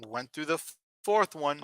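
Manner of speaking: ordinary talk, nothing marked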